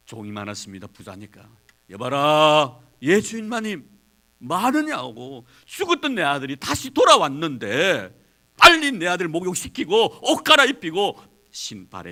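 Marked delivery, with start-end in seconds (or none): 1.9-12.1 louder father calling his servants; joyful master barking orders across the house; commanding shout. Acted. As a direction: call the servants loud and eager.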